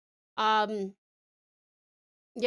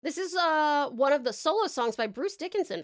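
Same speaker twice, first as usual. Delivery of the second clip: ordinary talk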